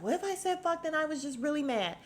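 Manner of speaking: high-pitched mocking imitation; mimicked defensive retort. Imitating a housewife excusing her cursing, defensive mimicry.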